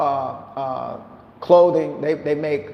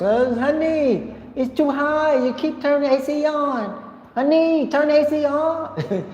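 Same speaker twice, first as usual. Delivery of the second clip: put-on wife's Vietnamese accent; 11 semitones higher than usual. Imitating his wife scolding about the AC bill, high Vietnamese accent. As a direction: mimic her nagging about the AC in a much higher, Vietnamese-accented voice. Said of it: "I gotta talk like a Vietnamese".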